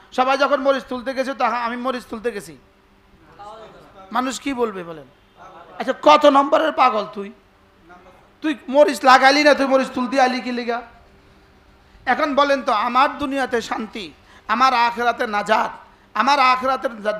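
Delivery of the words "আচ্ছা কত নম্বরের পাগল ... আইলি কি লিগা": loud, rough mocking rebuke; preacher bellowing a mock rebuke at a foolish man; mock scolding. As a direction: scold him like a fool caught out.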